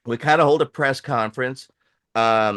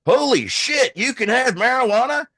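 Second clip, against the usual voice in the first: high, loud, shocked exclamation; shocked disbelief; 11 semitones higher, 3 dB louder than usual. Retold or imitated. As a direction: squeal it like a stunned border guard. Border guard shocked by paperwork.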